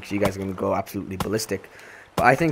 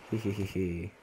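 cartoon laugh; 7 dB quieter than usual. Giggling to himself while building.

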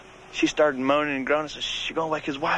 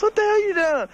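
high mock startled woman; quoting her; 5 dB louder than usual. Retelling the girlfriend's startled shout. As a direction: squeal it like she caught you. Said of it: "she goes".

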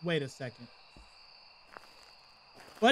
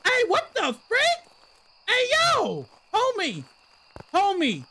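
mock outrage; 10 semitones higher, 6 dB louder than usual. Real, not acted. Ghost blows out lights.

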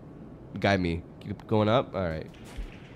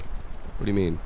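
ordinary delivery

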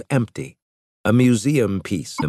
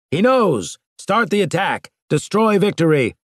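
high, loud battle shout; shouted order; 10 semitones higher, 3 dB louder than usual. A narrator's reading. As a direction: yell the attack order at the crew. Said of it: "and shouted".

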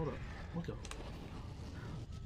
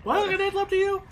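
normal talking voice

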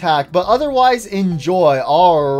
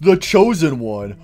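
self-important chosen one; 5 semitones lower than usual. Acted. Redstone head introducing himself to fans, self-important chosen one. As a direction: intone it low, with solemn pride. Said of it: "I am the Redstone Freddy Fozhead".

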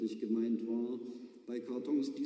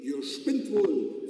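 louder, slow and grumbling; a mild mock curse from the driver; cursing bus driver; 5 semitones higher, 7 dB louder, much slower than usual. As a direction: swear at the passengers. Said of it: "fluchte der Busfahrer".

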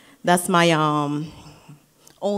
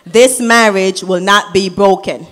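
firm, louder proclamation; louder declaration; 8 dB louder than usual. Real, not acted. Sister at the wedding proclaims it more firmly, louder. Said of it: "I'ma say it again".

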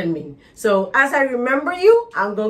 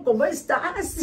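1 playful Spanish; 4 semitones higher, 4 dB quieter than usual. Acted. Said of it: "bring my Mexican out".

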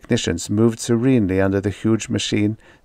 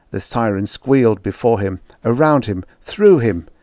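plain reading voice

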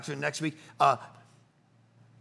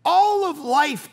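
loud, high, drawn-out proclamation; emphatic preaching; 9 semitones higher, 8 dB louder, slower than usual. Real, not acted. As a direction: stretch it out to drive the point home. Preacher drives home the point.

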